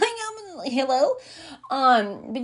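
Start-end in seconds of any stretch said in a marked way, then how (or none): 0.0-1.1 high, drawn-out hello; incredulous hello. Retold or imitated. Scolding a fearful coworker, incredulous and high. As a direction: pitch the um way up in disbelief.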